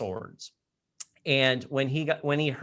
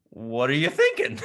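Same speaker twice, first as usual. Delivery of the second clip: amused disbelief; chuckling what are you thinking; laughing question; 13 semitones higher, 4 dB louder than usual. Retold or imitated. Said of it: "laugh heard".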